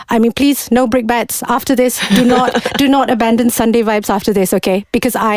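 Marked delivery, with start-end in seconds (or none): none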